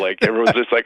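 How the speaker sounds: normal talking voice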